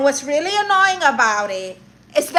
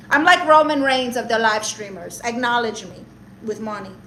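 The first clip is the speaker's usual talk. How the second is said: ordinary talk, nothing marked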